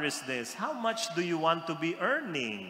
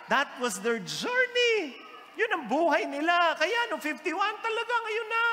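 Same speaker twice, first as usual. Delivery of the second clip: excited preaching rise; 11 semitones higher than usual. Acted. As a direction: lift it up with rising fervor. Preaching climax, pitch up.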